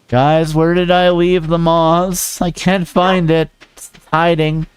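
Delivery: high, slow panicked calling; high frantic searching. Real, not acted. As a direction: call out in high alarm. Can't find the moss.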